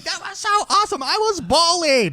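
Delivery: very high-pitched mock excitement; jazzed. Imitating amazed old people playing Nintendo, jazzed. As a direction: squeal it like an overjoyed player, hammed up. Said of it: "they're all, like, jazzed".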